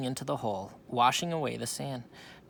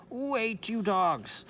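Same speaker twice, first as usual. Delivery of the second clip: desperate yell; 11 semitones higher, 5 dB louder, much slower than usual. A narrator's reading. Captain chases the rowboat.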